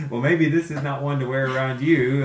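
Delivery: laughing. Real, not acted. Joking about partner's sneezing.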